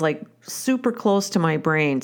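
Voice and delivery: normal talking voice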